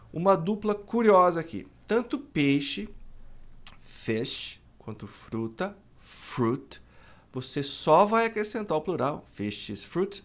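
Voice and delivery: ordinary delivery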